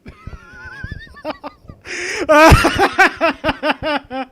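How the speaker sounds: a big, high burst of laughter; loud laugh. Real, not acted. Laughing at the line he just read.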